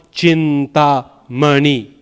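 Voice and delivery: "Chintamani" said very slowly, long syllables drawn out; stretched long syllables. Shown as a demonstration. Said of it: "Each longer one".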